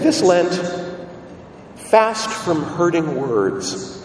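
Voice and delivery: drawn-out words with long pauses between lines; slow slogan reading. Retold or imitated. Preacher quoting a popular Lent slogan to churchgoers, slow and measured. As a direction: read it out very slowly, phrase by phrase.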